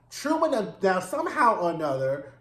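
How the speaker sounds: normal talking voice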